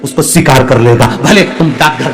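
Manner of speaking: fervent preaching. Acted. Guru urging the devotees to offer water anywhere, fervent loud preaching. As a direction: preach it full force, rousing the devotees.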